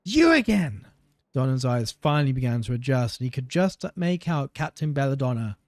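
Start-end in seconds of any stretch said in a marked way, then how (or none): 0.1-0.9 high, loud surprised shout. Acted. Guard recognizing the captain, loud shout. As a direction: shout it in disbelief. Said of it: "He shouted".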